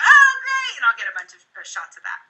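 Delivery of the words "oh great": much higher and louder; delighted. Shown as a demonstration. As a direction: burst out with delight. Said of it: "I'll go".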